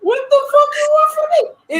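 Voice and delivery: real indignant outburst, high and loud; exasperated shout. Real, not acted. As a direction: throw up your hands and yell it. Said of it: "cry shout".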